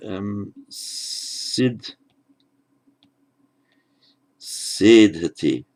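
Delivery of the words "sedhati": sedhati, slowly, with a long SE; stress on first syllable of sedhati. Shown as a demonstration. Teacher demonstrating a first-class verb, first-syllable stress on sedhati. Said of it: "with the stress on that first".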